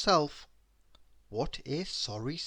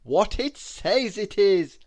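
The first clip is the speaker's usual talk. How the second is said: louder, slow, amused; laughing reply; 5 semitones higher, 8 dB louder, slower than usual. A narrator's reading. Mister Happy answers Miss Giggles, laughing. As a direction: tease her playfully. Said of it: "laughed Mister Happy".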